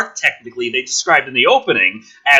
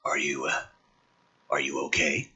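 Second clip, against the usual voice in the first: hushed concern; 11 semitones lower, 10 dB quieter than usual. Acted. Character line read quietly.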